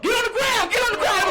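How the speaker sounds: high, loud barked command; startled police shout. Acted. Officer dad waking startled at his child, shouted order. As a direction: yell it like a cop jolted awake.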